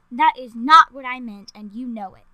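loud, sharp rebuke; sharp reply. A narrator's reading. The queen corrects the reporter, loud and sharp. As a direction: snap it back. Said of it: "Queen Samantha replied sharply".

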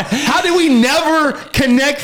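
high, slow, shouted; mock shocked shout. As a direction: ham up the big revelation. Mock conspiracy outburst.

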